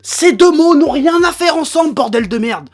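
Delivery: loud, furious ranting; angry rant. Real, not acted. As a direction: yell it out, fed up and furious. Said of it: "je me suis un petit peu énervé".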